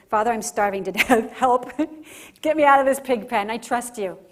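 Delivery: a lightly voiced desperate son, half laughing; desperate plea. Prodigal son begging his father from the pig pen, mock desperate. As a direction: beg loudly for rescue, tongue in cheek. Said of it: "He has imperfect contrition".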